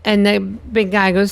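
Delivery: usual speaking voice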